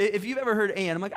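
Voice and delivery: normal talking voice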